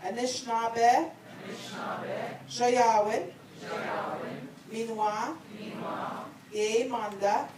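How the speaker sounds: phrase by phrase, long pauses for repetition; said slowly. Shown as a demonstration. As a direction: lead a group recitation, lifting each phrase's end. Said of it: "I'm gonna say it slowly".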